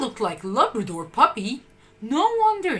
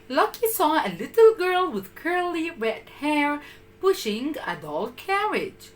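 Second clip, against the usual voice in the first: plain reading voice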